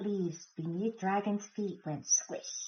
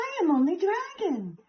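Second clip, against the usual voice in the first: Dragon; 8 semitones higher than usual. A narrator's reading. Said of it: "said Dragon".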